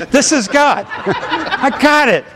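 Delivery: amused laugh breaking into the line; real laughter at the memory. Real, not acted. Amused at the woman's repetition.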